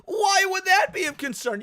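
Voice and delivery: sneering shouted question; sarcastic shout. Real, not acted. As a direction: yell it like it's obvious. Sarcastic rhetorical outburst.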